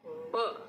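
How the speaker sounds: short ब with a puff of air; phonics b sound. Shown as a demonstration. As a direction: lips pressed, release ब with pressure. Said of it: "बी के लिए होता है". (होंठ बंद करके दबाव से ब)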